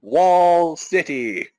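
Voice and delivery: slow, grand title read; dramatic announcement. Acted. Narrator unveiling the city, slow and grand. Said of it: "dramatic place name".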